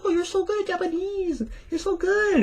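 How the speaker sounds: very high gushing compliment voice; high-pitched flattering praise; Japanese people complimenting. Retold or imitated. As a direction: gush high and sweet like a polite Japanese host. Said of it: "they're like".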